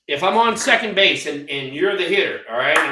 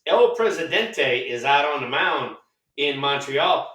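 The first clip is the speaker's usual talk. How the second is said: usual speaking voice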